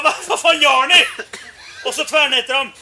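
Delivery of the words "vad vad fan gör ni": high, loud incredulous yell; raised, agitated voice, half laughing; retold shout. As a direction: yell at them in disbelief. Retold shout at snowmobile riders.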